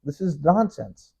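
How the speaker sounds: ordinary delivery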